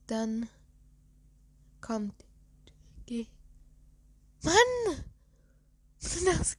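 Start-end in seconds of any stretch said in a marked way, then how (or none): 4.4-6.6 exasperated outburst. Real, not acted. Frustrated sneeze won't come.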